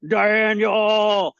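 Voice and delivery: echoing the silly high voice right back; weird voice. Retold or imitated. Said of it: "I did it 'cause you did it".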